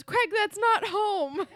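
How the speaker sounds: high, loud, drawn-out holler; imitating an exasperated girlfriend's shout; mock yell. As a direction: holler after someone, hammed-up. Imitates Ashley yelling after Craig.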